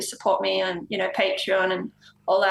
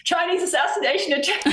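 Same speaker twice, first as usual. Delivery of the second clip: much higher, mock-panicked bodyguard voice; alarmed bodyguard; 8 semitones higher than usual. Bodyguards blocking the waitress, in a mock-panicked high voice. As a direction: squeal it out like a startled bodyguard.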